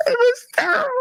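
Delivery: very high, squealed through laughter; laughing verdict. Real, not acted. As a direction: crack up on every word. Laughs at how bad it was.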